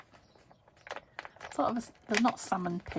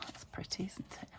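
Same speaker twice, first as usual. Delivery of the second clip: soft admiring whisper; 10 dB quieter than usual. Real, not acted. Admiring quietly to herself.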